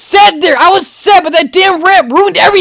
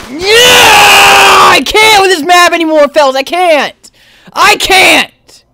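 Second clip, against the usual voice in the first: exasperated yelling; 3 semitones higher, slower than usual. Real, not acted. Player who can't with this map, exasperated yelling. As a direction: yell it like you have had enough.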